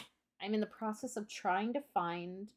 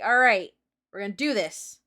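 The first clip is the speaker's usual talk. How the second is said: pumped-up call; 14 dB louder than usual. Retold or imitated. Psyching self up for a test.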